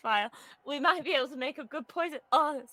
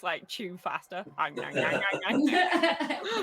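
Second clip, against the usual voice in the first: chewing noises; 4 semitones lower, much slower than usual. Pretend. Mimes chewing a flower.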